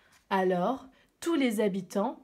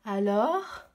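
plain reading voice

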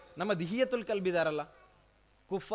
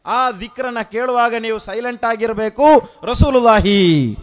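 raised voice building to a long cry; loud exhortation; 5 semitones higher, 13 dB louder than usual. Real, not acted. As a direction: urge the crowd, then draw out the last word loudly. Preacher exhorts, ends in loud cry. (ಜೋರಾಗಿ ಎಚ್ಚರಿಸುವ ಪ್ರವಚನ)

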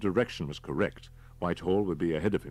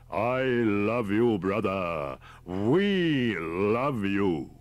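loud, very slow, stretched-out proclamation; booming voice; 3 semitones higher, 5 dB louder, much slower than usual. Acted. Big cult leader proclaiming to his captive before the flock, booming voice. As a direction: boom it out like a fervent preacher. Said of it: "The big man's voice boomed out".

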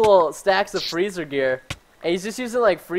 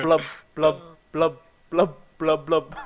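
gulping fish noises; dead fish blubbing; 4 semitones lower, slower than usual. Pretend. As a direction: mouth it like a fish out of water. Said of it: "I look like a dead fish".

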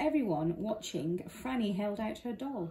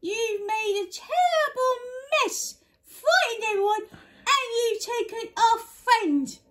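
brave; 12 semitones higher, 6 dB louder than usual. Acted. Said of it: "she said bravely".

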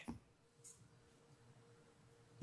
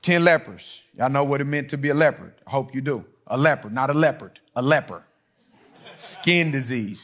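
ordinary talk

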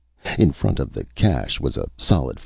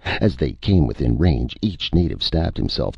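ordinary delivery, nothing marked

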